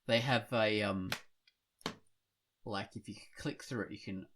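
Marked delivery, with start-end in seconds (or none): none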